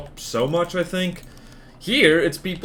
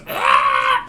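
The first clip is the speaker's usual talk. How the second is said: loud sharp unvoiced cat squawk; cat yelp; 10 semitones lower, 6 dB louder than usual. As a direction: bark a short Ah like the cat. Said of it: "she goes".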